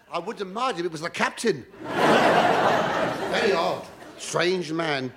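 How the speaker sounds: normal talking voice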